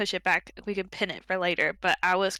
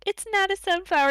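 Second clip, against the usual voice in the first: higher, small and timid; a light, raised shy girl voice; mild imitation of Fee, pitched up; shy Fee quote; 11 semitones higher than usual. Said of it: "she was just like".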